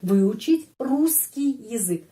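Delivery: Выучить русский язык said slowly, русский with a single clear s; careful slow demo. Shown as a demonstration. Teacher slowly reading a Russian phrase for students. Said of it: "Di sini kita melihat".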